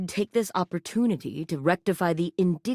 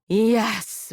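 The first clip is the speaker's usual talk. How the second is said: ordinary talk, nothing marked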